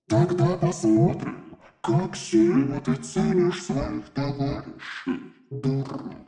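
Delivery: slow, soft, menacing demon voice; fire demon. Acted. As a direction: threaten like a cold archdemon. Demon threatens paladin.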